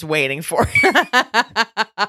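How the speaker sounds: slow, laughing through words. Real, not acted. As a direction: laugh while saying it. Laughing at own joke.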